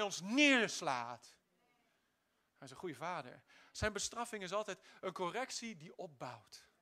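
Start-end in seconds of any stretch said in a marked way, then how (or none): none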